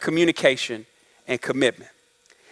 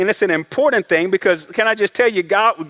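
ordinary delivery

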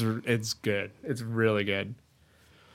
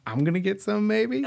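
much higher, hopeful squeak breaking into laughter; hammed-up hopeful dork, rising high; dorky kid; 12 semitones higher than usual. Host acts a dorky kid sensing a chance, squeaky hope. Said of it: "But the dorky kid's like".

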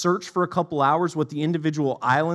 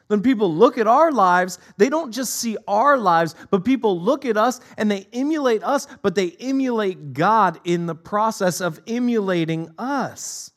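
rising, louder preacher build; preaching; 6 semitones higher, 5 dB louder than usual. Acted. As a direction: drive the point home to the congregation. Preaching at full force.

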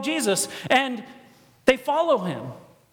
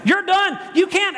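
normal talking voice

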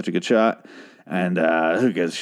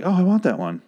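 eager wanting; 8 semitones higher than usual. Retold or imitated. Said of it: "I was like".

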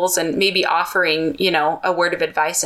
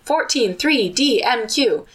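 lightly shouted list, pitched up; mild quizzing shout; 5 semitones higher, slower than usual. A narrator's reading. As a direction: call out each item higher, quiz-style. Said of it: "He keeps shouting these".

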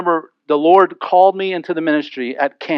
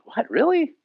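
much higher, softer startled question; shocked disbelief; 10 semitones higher, 7 dB quieter than usual. Retold or imitated. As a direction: act astonished. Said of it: "I'm like".

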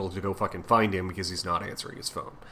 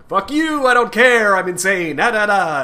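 much higher and louder, a mock unhinged villain rant; raving Goblin; 10 semitones higher, 6 dB louder than usual. Goblin screaming at Peter that he doesn't care, hammed-up high rant. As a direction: ham up a deranged supervillain brushing Peter off.

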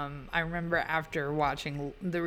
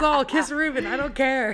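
loud, high, giddy outburst; laughing mock demand; 7 semitones higher, 8 dB louder than usual. Fan urging two movie characters to kiss, loud laughing mock demand. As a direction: playfully demand it through laughter.

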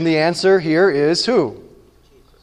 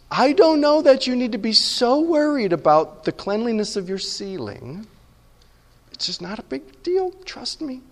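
higher, much softer, lightly voiced Jesus as roommate; gentle roommate Jesus; 5 semitones higher, 3 dB quieter than usual. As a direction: softly play Jesus reassuring you about chores.

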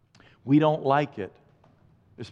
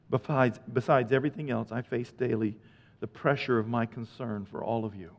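tender; 6 semitones lower, 5 dB quieter than usual. Acted. Soft tender turn.

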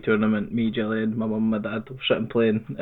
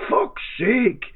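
screech of agony; 11 semitones higher than usual. Retold or imitated. The dad losing to his son, high anguished yell. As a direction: howl like a sore loser. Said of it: "screech of agony".

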